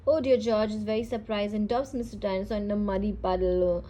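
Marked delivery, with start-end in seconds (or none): 0.1-0.4 sudden loud oh dear; startled. A narrator's reading. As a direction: caught off guard. George startled.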